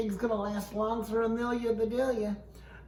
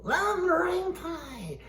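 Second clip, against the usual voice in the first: delighted; 10 semitones higher than usual. Acted. Delighted high exclamation.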